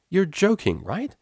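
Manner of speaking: high, loud disbelief; incredulous. Acted. Vinyl gasping at Octavia's music taste, incredulous. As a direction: Vinyl can't believe what she hears.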